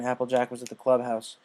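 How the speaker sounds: plain reading voice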